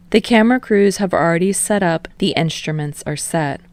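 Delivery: ordinary delivery, nothing marked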